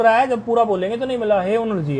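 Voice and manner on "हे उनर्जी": lower, softer हे उनर्जी, said he un-r-ji; n before r. Shown as a demonstration. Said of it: "ऐसे". (हे उनर्जी में पहले नकार)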